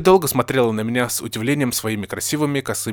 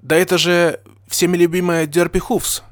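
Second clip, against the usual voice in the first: high, loud excited pony voice; delighted greeting; 7 semitones higher, 5 dB louder than usual. A narrator's reading. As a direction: announce the guest with excitement. Pony greets beloved friend.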